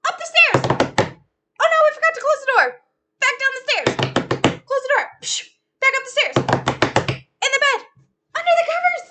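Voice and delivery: high, loud hurried shouting; breathless playful fleeing calls; frantic mock panic. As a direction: rush the kids home in a mock panic. Fleeing the bear home.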